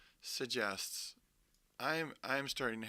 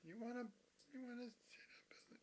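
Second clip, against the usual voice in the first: much higher whisper to the pet; whispered pet talk; 13 semitones higher, 17 dB quieter, faster than usual. Real, not acted.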